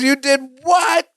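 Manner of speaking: high, slow, outraged mock disbelief; mean outburst. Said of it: "nice Cousin Larry becomes mean Cousin Larry".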